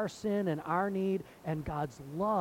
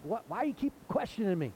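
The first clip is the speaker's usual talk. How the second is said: the healed blind man retorting to the leaders, higher and faster; exasperated retort; 10 semitones higher, 3 dB louder, faster than usual. Retold or imitated. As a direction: pitch jumps way up, fast and incredulous. Healed man challenges religious leaders.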